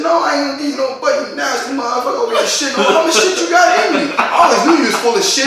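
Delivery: hammed-up scolding girlfriend voice; mock scolding girlfriend. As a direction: scold him like his disgusted girlfriend. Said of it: "She said".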